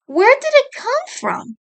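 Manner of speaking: leaning in, wide-eyed question; curious sister. A narrator's reading. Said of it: "Monaco asked".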